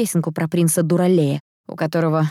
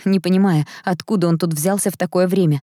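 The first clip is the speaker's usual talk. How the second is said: plain reading voice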